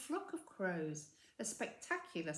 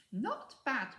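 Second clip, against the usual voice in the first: sneery; 5 semitones higher, 5 dB louder than usual. A narrator's reading. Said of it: "said a sneery voice".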